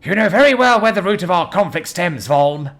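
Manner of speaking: high, loud accusing shout. A narrator's reading. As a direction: denounce him by name. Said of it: "Denelo shouted at him".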